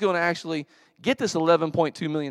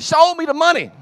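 very loud, high, hollered catchphrase; imitated demanding yell; 12 dB louder than usual. Rod demands proof from Jerry on the phone, loud yell. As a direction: holler it like a fired-up athlete. Quotes Rod's yelled demand.

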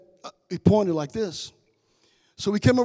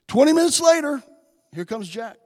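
normal talking voice

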